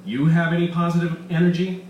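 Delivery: scoffing. Acted. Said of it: "Elaine scoffed".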